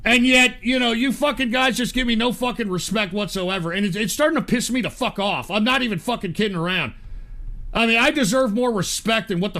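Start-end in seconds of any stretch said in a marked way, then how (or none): none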